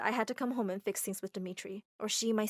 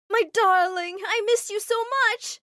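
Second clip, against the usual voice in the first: joyful reunion; 11 semitones higher, 9 dB louder than usual. Acted. Mom hugs Armitage.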